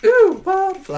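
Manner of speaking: high, airy "Ooh, butterfly"; dreamy high ooh. Acted. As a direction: float up high in wonder. Acting distracted character.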